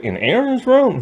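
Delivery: high-pitched disbelieving question; mock a grandfather's incredulity, pitch leaping up; incredulous grandpa. Grandpa grilling the boy about his daughter's room, incredulous. Grandpa reacts to James staying over.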